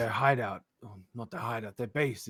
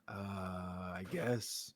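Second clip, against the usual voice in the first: unsure Duke; 3 semitones lower, 5 dB louder, much slower than usual. Acted. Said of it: "Duke looks at him".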